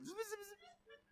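tiny high, soft zipping sounds; rapid little high zips under the voice; imitated zipping noise. Preacher mimicking his Chihuahua's movement, imitated zipping noise.

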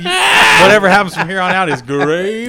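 loud, high gloating; triumphant boast. Retold or imitated. Player gloating after a natural hundred, triumphant. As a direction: crow it in retold triumph.

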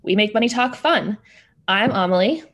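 usual speaking voice

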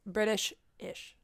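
British-ish drawn out slowly and softly; slow punchline. Shown as a demonstration. Friend slowly reveals her pun to the group, soft and slow. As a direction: land British-ish slowly, almost whispered. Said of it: "What do you call something that's a little British?".

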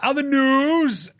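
segue announcer. Acted. Segue, slow high.

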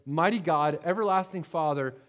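ordinary delivery

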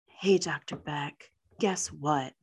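low menacing whisper. Retold or imitated. Sophie whispering a threat to Doctor Beck. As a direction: breathe the threat like a secret. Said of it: "and she's like".

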